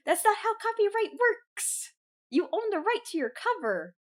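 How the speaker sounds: high, incredulous exclamation; can't believe they don't get it; exasperated outburst. Real, not acted.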